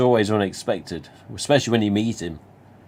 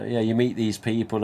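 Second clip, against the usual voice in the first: usual speaking voice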